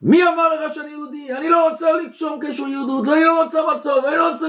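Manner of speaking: high, fast shouted outburst; retold shout. Woman in waiting room shouts at rabbi's son, high shout. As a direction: yell it high and hard, pushing him away. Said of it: "והיא מתחילה לצעוק עליו".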